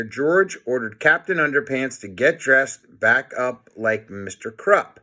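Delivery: usual speaking voice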